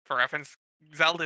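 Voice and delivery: normal talking voice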